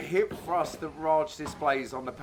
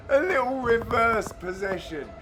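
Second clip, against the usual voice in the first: higher pitch, breaking out of laughter; laughing; 5 semitones higher than usual. Real, not acted. Commentator cracking up at the replay, laughing. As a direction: squeeze the words out after cracking up.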